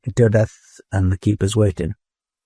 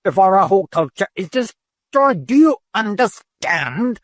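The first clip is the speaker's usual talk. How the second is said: much higher, furious outburst; exploding in anger; 9 semitones higher than usual. A narrator's reading. Eldest keeper exploding at the disobedient robot. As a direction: erupt at the robot, pitch climbing. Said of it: "the eldest keeper exploded".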